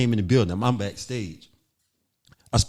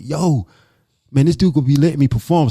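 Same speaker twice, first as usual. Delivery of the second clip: loud, fast, thrilled outburst; excited; 4 semitones higher, 9 dB louder than usual. Retold or imitated. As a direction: blurt it out like a kid in a candy store. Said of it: "So I'm excited".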